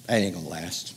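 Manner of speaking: low, soft skeptical aside; private thought aside. Retold or imitated. Guests thinking to themselves about the couple, muttered skeptical aside. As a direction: say it under your breath. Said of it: "we're thinking to ourselves".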